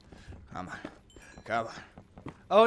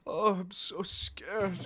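high, slow mock fright; hammed-up fake fear; mock scared; 9 semitones higher, 4 dB louder, slower than usual. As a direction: ham up being frightened, slowly.

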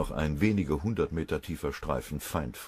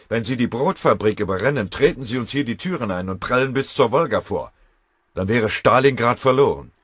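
loud, much higher rallying speech; impassioned command; 7 semitones higher, 10 dB louder than usual. Acted. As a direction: swear to hold the last bastion. Kriegsrat beschwört letzte Verteidigung.

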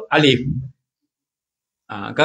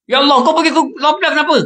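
higher, fast mock whine; complaining to God; 9 semitones higher, faster than usual. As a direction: act out a petty grumble at God, quickly. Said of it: "Kita dia kata". (rungutan pura-pura, tinggi dan laju)

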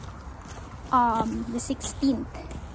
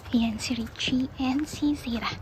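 whisper; 8 dB quieter than usual. Real, not acted. Showing sleeping family on camera.

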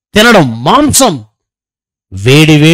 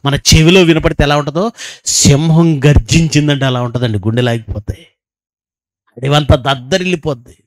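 ordinary talk

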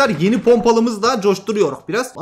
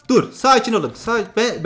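ordinary talk, nothing marked

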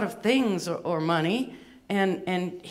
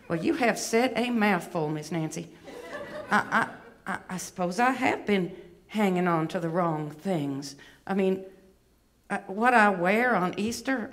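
chastened Southern belle puppet; put-on Atlanta accent, reflective; Miss Bevy. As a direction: admit it haltingly in a Southern accent. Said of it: "she does have a bit of an accent".

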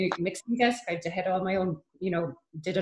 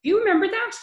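high, loud burst of surprise; astonished; 14 semitones higher, 8 dB louder, faster than usual. Real, not acted. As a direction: exclaim in real disbelief that it's remembered. Amazed at the other's memory.

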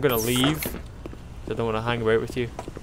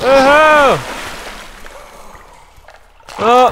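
excited shout; 9 semitones higher, 8 dB louder, much slower than usual. Real, not acted. Gamer exclaims.

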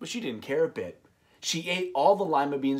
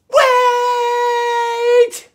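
put-on high, loud child's shout; desperate cry; 13 dB louder than usual. Camilla calling the old woman back, loud high cry. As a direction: call her back in a panic. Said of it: "she cried".

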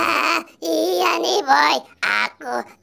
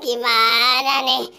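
usual speaking voice

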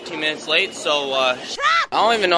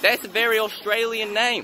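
higher-pitched put-on Australian accent; playful Australian accent; Aussie accent; 8 semitones higher than usual. Shown as a demonstration. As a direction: comment in a broad mock Aussie accent. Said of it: "I want you to rate my Aussie accent".

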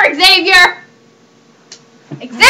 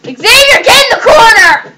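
higher, louder yell at the pet; angry shout; 6 semitones higher, 6 dB louder than usual. Real, not acted. Owner yelling at Xavier to go to the corner, shouting. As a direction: bellow the order across the room.

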